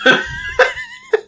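high squealing burst of laughter; real helpless laugh, way up high; shrieking laugh. Real, not acted. Cracking up at the deaf gorilla idea, shrieking laugh.

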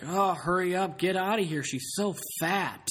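hammed-up drunk panic; urgent drunk. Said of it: "He's like".

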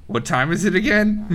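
dwarf cracking up as he cues his brother; dwarf, laughing. Acted. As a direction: ask it with a knowing giggle. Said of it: "He looks at Marvis and goes".

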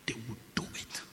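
hushed whispered aside. Real, not acted. Preacher whispers the point to the church. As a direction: drop to a whisper for effect.

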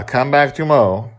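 ordinary delivery, nothing marked

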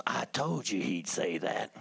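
low, slow aside in another man's voice; man to wife. Retold or imitated. Man telling his wife after the answer, low and slow.

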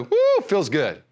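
high mock-elated "woo", drawn out; joyful whoop. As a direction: whoop it up like an emotional believer.

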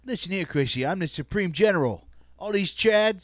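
Tony Soprano. Acted. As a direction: do a hammed-up mobster laying down a threat. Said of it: "It's hard for me to do like Tony".

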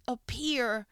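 ordinary talk, nothing marked

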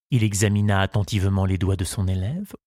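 ordinary delivery

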